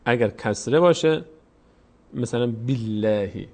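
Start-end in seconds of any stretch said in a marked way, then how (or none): 2.5-3.5 "باللهی" with a light "l" and fronted vowel; thin Allah. Shown as a demonstration. Teacher's example after kasra, thin "l". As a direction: spread the mouth for a thin "le" in "باللهی". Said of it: "موقع دیگه پر خونده نمیشه".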